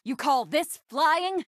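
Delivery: high, drawn-out disbelief; slow, exasperated question rising high; incredulous jab. Acted. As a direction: needle the pilot, stretching the words. Scolding the pilot's flying.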